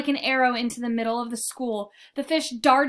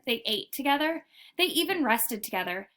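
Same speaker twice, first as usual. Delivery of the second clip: usual speaking voice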